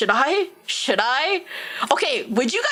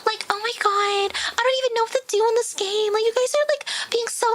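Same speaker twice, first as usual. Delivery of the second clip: fake cute streamer; 10 semitones higher than usual. Mocking a faked high energy stream persona, much higher cutesy voice. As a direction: play a tiny fake-sweet persona. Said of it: "if I actually try to fake it".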